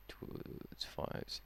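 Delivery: whispered mental maths; whispering. Real, not acted. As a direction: whisper the sum to yourself. Working out sum under breath.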